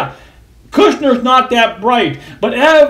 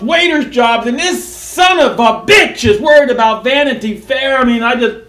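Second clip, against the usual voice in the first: loud, high furious rant; wild unhinged ranting; 5 semitones higher, 3 dB louder than usual. Real, not acted. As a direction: rant at full steam, outraged. Angry political rant.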